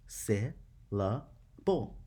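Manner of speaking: very slow, broken into separate beats; syllable by syllable. Shown as a demonstration. Teacher showing scholars how to count with hand under chin, syllable by syllable. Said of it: "saying the word syllable".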